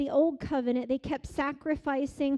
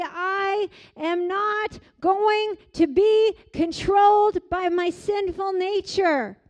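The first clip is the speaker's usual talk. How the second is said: slow, loud, higher vow; loud declaration; 6 semitones higher, 8 dB louder, slower than usual. Pretend. Preacher voicing a believer's vow, slow and loud. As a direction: proclaim it like a vow you mean.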